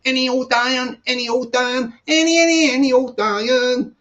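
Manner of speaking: put-on scrap man calling out for old iron; sing-song rag-and-bone cry; drawn-out sing-song street cry. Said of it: "good afternoon to the Scrap Man".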